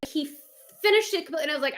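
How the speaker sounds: ordinary delivery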